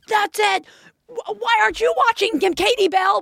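put-on high, sharp scolding at the kids; mock shrill outburst; retold yelling. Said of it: "I lost my mind".

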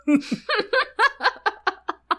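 long high-pitched shriek of laughter. Real, not acted. Laughing at a Diego line, long high shriek of laughter.

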